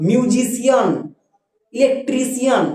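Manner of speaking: "মিউজিশিয়ান, ইলেকট্রিশিয়ান" with endings split into "সি-আন"; ভুল উচ্চারণ. Shown as a demonstration. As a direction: demonstrate the mistake, sounding "সিয়ান" in "মিউজিশিয়ান, ইলেকট্রিশিয়ান". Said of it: "আমরা এরকম উচ্চারণ করবো না". ("মিউজিশিয়ান, ইলেকট্রিশিয়ান"-এর শেষে টেনে "সিয়ান")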